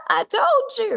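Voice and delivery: high, teasing told-you-so; high smug mock gloat. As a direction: rub it in playfully, voice jumping up high. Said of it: "And I'm like".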